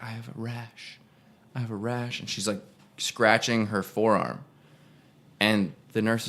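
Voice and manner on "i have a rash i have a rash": hushed and much softer, almost whispered; speaking really quiet. Retold or imitated. Old lady at the hospital glass, murmuring her complaint very quietly. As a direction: mimic a timid old lady murmuring to the nurse. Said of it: "she's speaking really quiet".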